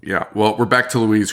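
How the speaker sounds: normal talking voice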